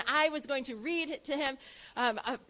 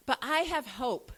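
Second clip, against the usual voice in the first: ordinary talk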